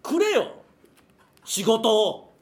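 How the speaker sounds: loud, high-pitched begging demand; indignant cry for work; mock demanding plea. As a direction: belt it out like a comic plea.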